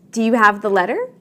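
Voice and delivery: yes-or-no question, voice climbing on "letter"; rising intonation. Shown as a demonstration. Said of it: "your voice rises when you ask".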